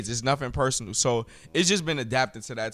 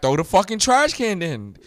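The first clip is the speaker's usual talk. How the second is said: louder, higher retold challenge; retold defiant dare; 5 semitones higher, 7 dB louder than usual. Teacher daring a student to throw a trash can, retold defiantly. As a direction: dare them, raising your voice.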